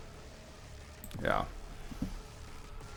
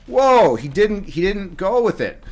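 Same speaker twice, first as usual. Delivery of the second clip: slow, high, loud startled whoa; real surprise, stretched and loud; surprised whoa; 6 semitones higher, 4 dB louder than usual. Real, not acted. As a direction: caught off guard by the move, drawn out. Opponent makes an unexpected move.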